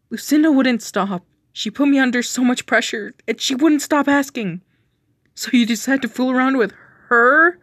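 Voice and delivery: heated argument. Acted. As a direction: push back hard. Argument continues, defense and accusation.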